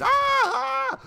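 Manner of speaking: sudden shrill yelp of pain, very high; imitated scream. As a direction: mimic his panicked scream of pain. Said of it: "he just started screaming".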